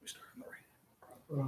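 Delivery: near-whisper, very soft and slow; hushed aside. Real, not acted. Teacher quietly correcting a student's reading direction, hushed. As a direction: drop to a whisper for a private correction.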